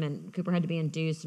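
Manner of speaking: ordinary talk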